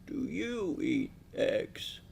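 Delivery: put-on slow old host quizzing the young guest; slow old man. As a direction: stretch it out like a tired old man. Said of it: "He talks slow".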